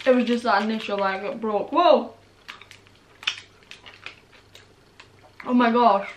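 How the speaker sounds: talking around sour candy; puckered sour reaction; candy in mouth. Real, not acted. Seen 3: Candy put in mouth at -9; sour grimacing and squinting throughout.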